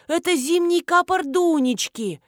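lightly voiced excited recognition; delighted exclamation. A narrator's reading. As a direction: exclaim in a lifted woman's voice. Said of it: "всплеснула руками Нина".

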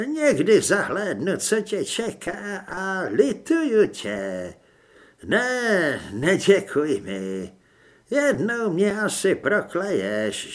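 put-on slow, grave voice of the old ghost; old ghost's warning. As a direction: pronounce it like a heavy prophecy. Starý duch Mordechaj varuje. (pomalý vážný hlas starého ducha)

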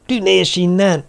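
high, frantic cry; shriek-like order to get away; yelling. A narrator's reading. Terrified boy waves off a phantom, yelling. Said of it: "ordította, kezeivel hadonászva".

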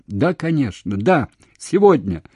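eager readiness. A narrator's reading. Woman agreeing to come today, eager. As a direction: agree as if rushing to meet him. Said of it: "со всегдашней своей готовностью".

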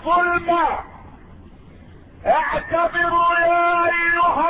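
high, ringing chant-like exhortation; thundering pulpit warning on one sustained high note; preaching at full force. Real, not acted. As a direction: hurl each call at the tyrants.

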